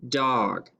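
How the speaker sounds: normal talking voice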